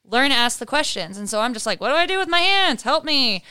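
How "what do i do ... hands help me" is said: high, quick helpless plea. Retold or imitated. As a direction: plead like a lost newbie. Retelling asking for help.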